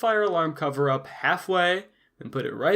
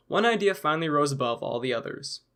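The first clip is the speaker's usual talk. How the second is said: ordinary talk, nothing marked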